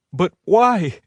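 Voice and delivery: high, pleading why; desperate. A narrator's reading. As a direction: push for an answer. She presses, distressed.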